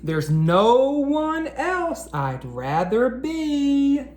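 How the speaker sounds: lively rhyming picture-book read-aloud; reading to children. Acted. Teacher reading a rhyming book to boys and girls, storytime read-aloud. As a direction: read each rhyme out to a room of young kids.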